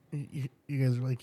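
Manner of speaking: normal talking voice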